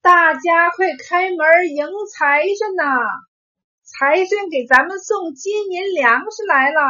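high, loud, excited-crowd call; villagers calling out. A narrator's reading. Neighbours calling everyone to welcome the God of Wealth, calling out loudly. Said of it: "忽听庄邻齐喊".

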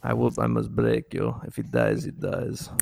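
low cold threat, like Drago; Ivan Drago impression. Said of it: "That's perfect".

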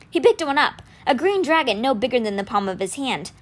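usual speaking voice

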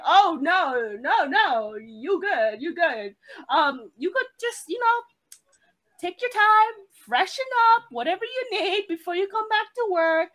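high, awkwardly soothing manager voice; imitated reassuring manager. Manager comforting a crying employee, imitated awkward reassurance. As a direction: play a flustered boss comforting someone.

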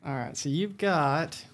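ordinary delivery

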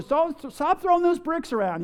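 high-pitched mock scolding; imitated parental telling-off. Parent scolding child over bricks.

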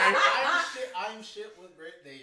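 laughing. Real, not acted. Caught forgetting a birthday.

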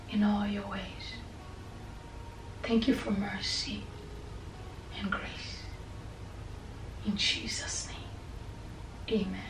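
soft, whispered prayer to God; trailing into whispered thanks; hushed prayer. Real, not acted. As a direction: pray quietly, close and intimate.

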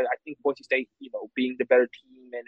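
usual speaking voice

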